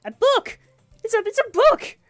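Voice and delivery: high, loud shriek of disbelief; overexcited outburst. Real, not acted. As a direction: yell it like an astonishing discovery. Overexcited reaction to getting a book.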